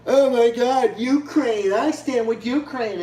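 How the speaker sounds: high, sarcastic mock of a gushing liberal; overdone sarcastic fake-concerned voice. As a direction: parody their breathless outrage. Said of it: "and act like".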